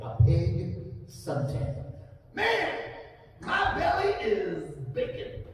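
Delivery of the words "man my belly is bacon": slow, pitched-up pig voice; sunbathing pig. Acted. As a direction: drag it out like a pig lying in the sun. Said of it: "My impression of a pig suntan".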